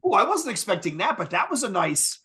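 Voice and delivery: mock-surprised high "oh", settling into approval; high, impressed double take; pleasantly surprised. Retells his surprise at aggressive parts.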